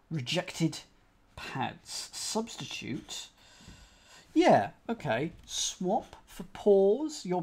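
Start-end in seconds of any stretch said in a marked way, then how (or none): 2.1-3.3 muttered. Real, not acted.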